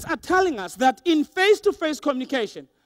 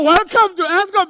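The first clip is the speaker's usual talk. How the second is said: high, loud, resentful welcome; hammed-up irritated greeting; annoyed receptionist; 6 semitones higher, 8 dB louder than usual. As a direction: bark a greeting that means leave me alone. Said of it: "the tone is telling me".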